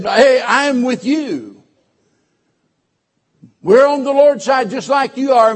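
loud, high, ringing preacher's call; full-force preaching. Acted. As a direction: preach it out to the whole congregation.

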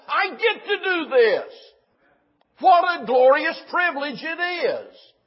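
very high, loud, exultant pulpit delivery; ringing, impassioned sermon climax; full-force preaching. Real, not acted. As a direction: proclaim it to the whole congregation.

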